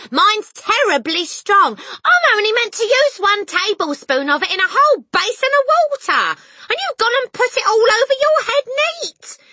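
high, loud exasperated tirade; scolding cry. A narrator's reading. Mother scolding Harry over the bottle, shrill cry. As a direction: lecture him at full voice. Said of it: "the mother cried".

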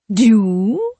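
loud needling witch voice. A narrator's reading. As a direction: needle her with one word. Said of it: "in a needling voice".

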